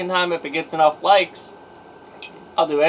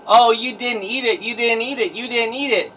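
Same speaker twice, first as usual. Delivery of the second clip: high, taunting sing-song mimicry; mock the doubters in a high whine; mocking whiny voice; 8 semitones higher than usual. Mocking imagined doubting viewers.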